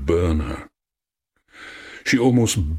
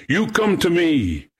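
high call, falling at the end; shouting; 11 semitones higher than usual. A narrator's reading. Young man calling the hidden voice to him, high shout. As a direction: summon someone far off in a high voice. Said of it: "he shouted".

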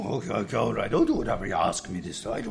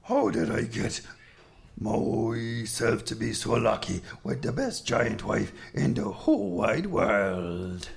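lovestruck giant; 10 semitones higher than usual. Acted. Finn telling his giant wife how lucky he is, adoring. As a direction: marvel at your lucky life.

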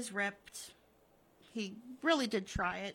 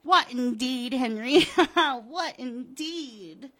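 loud, tipsy cackling mockery; boozy scornful amusement; drunken laughing; 4 semitones higher, 11 dB louder, slower than usual. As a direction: laugh through it, loud, drawing out the last words. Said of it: "she's laughing, and she's drunkenly getting into her car".

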